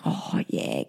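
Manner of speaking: low unvoiced whisper; whispered reply. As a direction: hush it back teasingly. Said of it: "I'm like".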